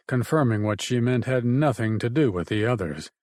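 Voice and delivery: ordinary delivery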